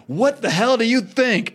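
high, drawn-out angry retort; acted outrage. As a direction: play an incensed man, stretching the words. Acted quarrel between two friends.

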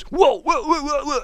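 high, flustered babble of protest; high-pitched mock panic; all hyper. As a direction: imitate a friend getting worked up. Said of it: "gets all hyper".

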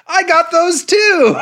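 high, loud, slow sister squeal; excited sister. Retold or imitated. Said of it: "My sister was like".

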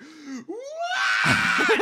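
high shrieking cry; mock anguished wail.

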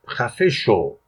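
ordinary delivery

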